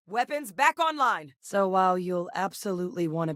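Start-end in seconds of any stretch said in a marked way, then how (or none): 0.1-1.3 high, urgent status call; combat callout. Acted. Crew member reporting weapons restored, urgent callout.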